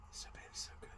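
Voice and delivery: He's so bad, He's so good whispered under the breath; a soft whispered echo of the praise. Real, not acted. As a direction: breathe the words as a hushed secret. Repeating praise, then whispered.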